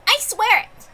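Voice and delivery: usual speaking voice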